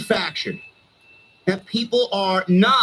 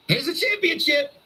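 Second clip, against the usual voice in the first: mocking handout; 6 semitones higher than usual. Mocking handing her titles.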